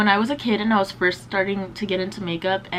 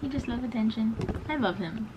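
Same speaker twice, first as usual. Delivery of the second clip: soft doting voice, pitched up; 3 semitones higher, 5 dB quieter than usual. Real, not acted. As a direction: coo at the animal. Baby-talking to her pet.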